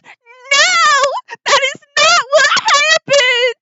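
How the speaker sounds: very high, wailing protest; hysterical denial. Acted. Revived lady contradicting the guard's account, hysterical. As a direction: protest in a frantic, high wail. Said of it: "And she says".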